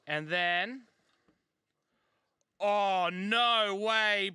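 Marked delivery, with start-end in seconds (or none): none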